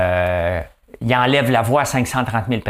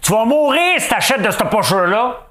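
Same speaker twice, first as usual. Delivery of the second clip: much higher, louder mock warning; 13 semitones higher, 4 dB louder than usual. Education campaign warns people off bad bags, mock high warning. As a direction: squeal it like a hammed-up scare campaign. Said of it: "à dire aux gens".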